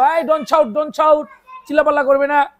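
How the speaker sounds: put-on loud, high "don't shout" at noisy kids; scolding shout. Shown as a demonstration. Teacher shouting at chattering pupils to stop, loud and high. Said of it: "আমি কি বলবো?".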